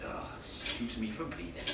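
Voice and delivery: low, soft, sulky sarcasm; sarcastic mutter after being told off. Acted. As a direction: mutter it back, offended. Sarcastic aside after rebuke.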